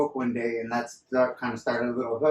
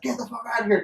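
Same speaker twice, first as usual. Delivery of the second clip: high mock boss snap; boss dismissing; 10 semitones higher, faster than usual. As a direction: wave him off in a pitched-up boss voice. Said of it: "He's like".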